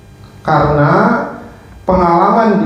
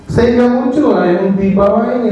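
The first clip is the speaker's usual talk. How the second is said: ordinary talk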